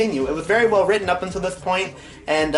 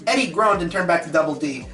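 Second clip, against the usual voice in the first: usual speaking voice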